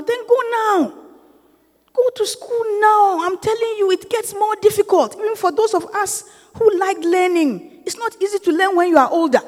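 fired-up exhortation to the church; preaching at full force. Acted. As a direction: preach it hard, pressing them to go now. Urging the congregation toward school.

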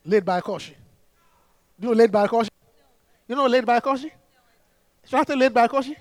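"Laid by Koshi" said as English words, "laid-bye-KOH-shee"; foreigner's mispronunciation. Acted. Returnee acting like a foreigner, mispronouncing "Laid by Koshi" as English. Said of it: "trying to act like a foreigner".

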